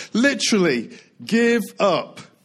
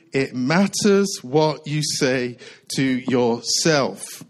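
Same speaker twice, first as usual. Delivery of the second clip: half-speed, lower, each word set apart; slow emphasis; 4 semitones lower, much slower than usual. Shown as a demonstration. Preacher giving the congregation his main point, slow and weighty. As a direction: deliver the key point word by word. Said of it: "The words I want to focus on is this".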